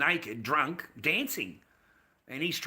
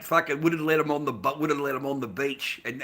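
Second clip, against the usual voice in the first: ordinary talk, nothing marked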